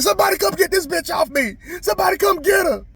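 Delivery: high, loud panicked screech; screaming for help. Retold or imitated. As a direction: shriek for rescue like a kid. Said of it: "I started screaming and shit".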